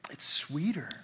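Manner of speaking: a whispered aside, hushed and intimate; almost a secret whisper. Acted. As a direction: drop to a whisper on it. Savouring the sweetness of scripture.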